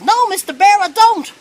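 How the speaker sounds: very high, very loud cartoon rabbit. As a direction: pipe up as the joke rabbit, far above your own voice. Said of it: "the rabbit turns around and he goes".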